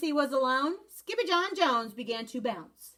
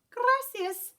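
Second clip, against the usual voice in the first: high squeak; 13 semitones higher, 3 dB louder than usual. A narrator's reading. Skipito thanks.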